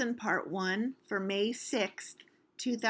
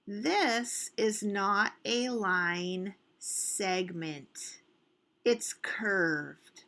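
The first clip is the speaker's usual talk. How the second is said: slow, spaced-out words; slow emphatic explanation; much slower than usual. Real, not acted. Teacher telling children a curve is no line segment, slow and emphatic. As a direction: spell it out slowly, word by word. Teacher stresses a key correction.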